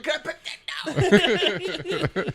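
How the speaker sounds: high laughing meme quote. Retold or imitated. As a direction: squeal it through laughter. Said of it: "I was like".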